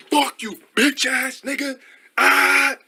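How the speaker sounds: high, drawn-out put-on tirade ending in a yell; hammed-up squeezed rage, building to a loud cry; mock outburst. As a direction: act out a sore loser's blow-up. Acted hypothetical outburst at opponent.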